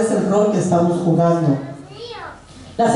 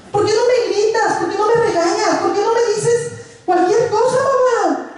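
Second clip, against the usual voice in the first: put-on much higher, whiny teen voice; teen daughter; 11 semitones higher than usual. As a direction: mimic the daughter's complaint. Daughter questions mother. (imitando a la hija quejosa)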